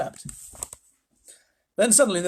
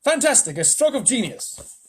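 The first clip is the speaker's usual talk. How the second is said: excited exclaiming; 9 semitones higher, 5 dB louder than usual. Acted. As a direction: rave about the genius. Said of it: "they all exclaimed".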